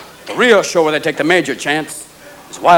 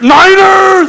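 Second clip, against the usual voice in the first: very high, loud fan shout; sudden fan yell; 13 semitones higher, 5 dB louder than usual. Acted. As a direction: burst into a rabid fan scream. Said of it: "all of a sudden, like, Niner field".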